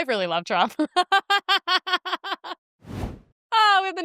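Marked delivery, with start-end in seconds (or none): 0.8-2.5 pitched-up giggling fit; helpless giggling; high giggle. Real, not acted. Laughs after line.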